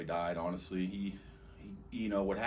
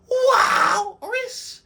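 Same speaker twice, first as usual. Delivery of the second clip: long, high hollered WALRUS; signature name call; 15 dB louder than usual. Shown as a demonstration. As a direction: holler it out, first syllable soaring and stretched. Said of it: "a way I said his name".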